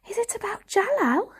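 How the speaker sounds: unvoiced breathy whisper. A narrator's reading. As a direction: whisper the guess eagerly. Varjak whispers guess.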